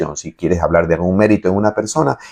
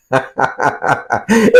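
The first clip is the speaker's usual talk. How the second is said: a short mocking laugh at critics; scornful laugh; 5 semitones higher than usual. Real, not acted. Preacher laughing scornfully at ignorant critics.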